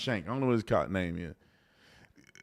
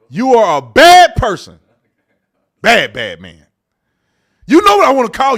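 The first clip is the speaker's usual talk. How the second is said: louder, punched-out playful insult; loud mock insult; 3 semitones higher, 11 dB louder than usual. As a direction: let the big reveal land loudly. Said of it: "I'm finna say it".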